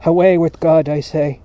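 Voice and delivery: very loud, emphatic dismissal; insistent shout. A narrator's reading. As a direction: shout it as a defiant command.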